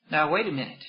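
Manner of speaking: usual speaking voice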